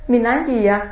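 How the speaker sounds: ordinary talk, nothing marked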